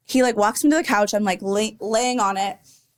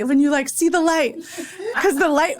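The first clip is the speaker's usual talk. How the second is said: higher pitch, breaking into laughter; amused giggle while telling it; laughing; 6 semitones higher than usual. Real, not acted. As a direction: laugh through the joke about seeing the light. Laughing at her own near-death joke.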